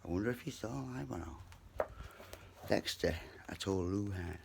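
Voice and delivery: usual speaking voice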